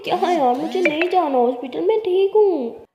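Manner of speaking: loud, high, stubborn refusal; loud protest. Acted. Lakshmi yells at Lili she won't go to hospital, loud and high.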